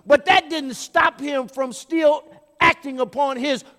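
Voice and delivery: high, forceful preaching voice. Real, not acted. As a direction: drive the point home to the congregation.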